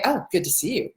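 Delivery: low, insincere little greeting; sardonic, unenthused hello; snarky greeting. Shown as a demonstration. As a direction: greet them with unwelcoming sarcasm. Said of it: "but it sounds horrible".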